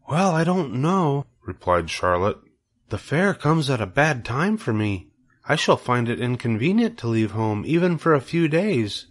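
a gentle hint of a wise spider, hesitant; Charlotte the spider.

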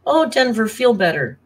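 normal talking voice

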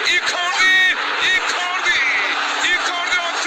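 high, repeated name shout; screaming football commentator. Real, not acted. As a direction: call the scorer's name in rising joy. Goal call in live commentary. (bağıran futbol spikeri)

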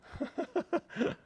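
a high bright burst of laughter; amused laugh. Real, not acted. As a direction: laugh at your own joke. Laughs after teasing model.

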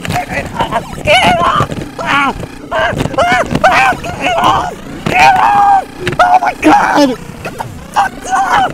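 shrieking in terror; high piercing screams; screaming at a spider. Acted. Bug on him, screaming.

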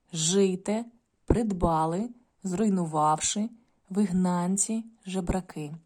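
each word said slowly with its stress marked; stress practice word list. Shown as a demonstration. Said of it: "в яких треба правильно ставити наголос". (повільне читання слів з чітким наголосом)

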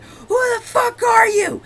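horrified shriek; shocked yell. Retold or imitated. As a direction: scream it like a dad betrayed. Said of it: "he's like".

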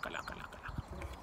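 trailing off into a whisper; fading whisper. Acted. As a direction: whisper the farewell away. Waving someone off.

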